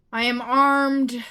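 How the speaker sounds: loud, drawn-out battle announcement; bold declaration. Pretend. Gamer announcing the weapon to the game, bold and loud. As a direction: declare it slowly at full voice.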